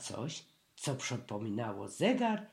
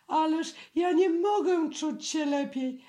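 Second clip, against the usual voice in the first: high, louder, panicky protest; scared little bear pleading; high fretful bear; 13 semitones higher, 6 dB louder than usual. A narrator's reading. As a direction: plead your way out of the shot. Frightened Pooh protesting.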